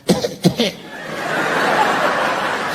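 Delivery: breathy mock cough, unvoiced; a quiet fake non-smoker cough. The pregnant woman's fake cough aimed at the smoking comedian, quiet and pointed. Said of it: "one of them fake non-smoker coughs".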